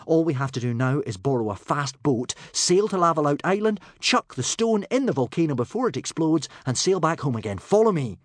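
brisk. A narrator's reading. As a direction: rattle off the plan and take charge. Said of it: "said Hiccup briskly".

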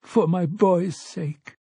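high, drawn-out tearful plea. A narrator's reading. Weeping father begs for his son's sake, tearful. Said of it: "replaced by his own tears".

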